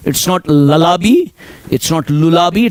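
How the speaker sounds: लल्लाबी, छोटा 'बी' के साथ; 'lullaby' को गलत ढंग से 'लल्लाबी' बोलना; wrong pronunciation. Shown as a demonstration. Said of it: "it's lullaby". (गलत उच्चारण 'लल्लाबी')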